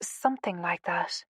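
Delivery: soft, hushed admission; subdued, half-whispered reply. A narrator's reading. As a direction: trail it out quietly. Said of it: "she whispered into the darkness".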